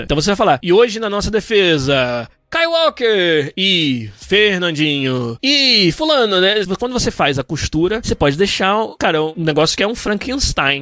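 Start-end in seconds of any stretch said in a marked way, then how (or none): 0.6-6.4 slow lineup read with even tune throughout; steady narrator intonation. Shown as a demonstration. Narrator reading the defense lineup, slow and even. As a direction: keep the announcer tune level across names. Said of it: "quando você não tem a entonação correta".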